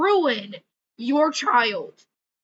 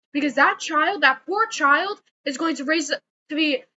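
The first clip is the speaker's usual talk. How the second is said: ordinary talk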